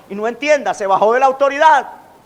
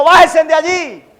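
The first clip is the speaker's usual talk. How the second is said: loud, higher, slow bájese de allí; shouting; 5 semitones higher, 4 dB louder than usual. Retold or imitated. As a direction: shout it down as religion. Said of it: "La religión le está diciendo". (grito alto y lento de la religión)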